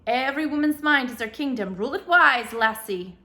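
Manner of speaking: lilting Irish grandfather sharing a saying; thick Irish brogue. A narrator's reading. Ancestor Patrick advising Haley in a thick Irish brogue. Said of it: "said her great-great-great-grandfather".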